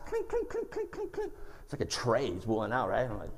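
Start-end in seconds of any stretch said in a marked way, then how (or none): 0.1-1.3 very high, soft glassy clinks; clanking glass imitation. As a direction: squeak out rapid little clinks. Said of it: "I hear his clanking glass".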